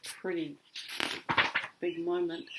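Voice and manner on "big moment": plain reading voice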